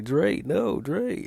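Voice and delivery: high, loud pleading cry of her name; desperate shout of the name; panicked cry. Retold or imitated. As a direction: yell her name as the blows land. Victim cries out while struck.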